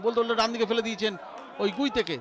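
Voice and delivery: ordinary talk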